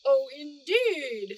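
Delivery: slow, loud flustered head judge. A narrator's reading. Head judge taken by surprise, slow and flustered. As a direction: caught off guard and fumbling. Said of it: "somewhat flustered".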